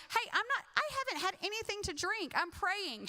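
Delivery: a woman insisting she's sober; defensive Hannah. Retold or imitated.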